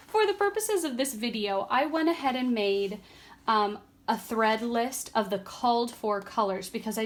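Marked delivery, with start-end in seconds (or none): 0.0-1.7 laughing. Real, not acted. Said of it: "excuse me".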